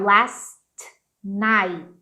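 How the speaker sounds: "last night" with each T popped, a gap between; exploded final T. Shown as a demonstration. Teacher showing learners "last night" with exploded final Ts. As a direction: release the T hard in "last" and "night". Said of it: "que explode".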